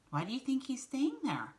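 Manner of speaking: usual speaking voice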